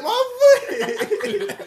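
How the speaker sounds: retelling his own crying over the nail, high laughing wail; mock crying wail. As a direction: wail it like a kid in pain, half laughing. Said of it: "I was crying like fuck".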